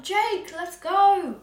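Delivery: acted shout. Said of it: "I'm gonna s-, shout".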